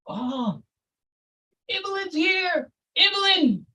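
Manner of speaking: very high, slow, crooning greeting; gushing greeting. Retold or imitated. The preacher welcoming Evelyn, high and gushing. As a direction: gush over her like a showman.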